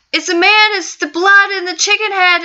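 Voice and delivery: high, loud panicked telling; terrified. Acted. Terrified girl tells uncle about the chicken man, panicked. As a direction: gasp it out in terror.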